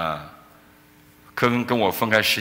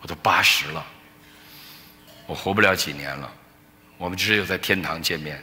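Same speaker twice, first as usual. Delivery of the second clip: low, subdued retelling of a tearful lament; tearful mother; 4 semitones lower, 3 dB quieter than usual. Retold or imitated. Elderly mother fearing she won't see her son, retold sorrow. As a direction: softly echo a mother's sorrow, pitched low. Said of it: "我妈妈就哭说".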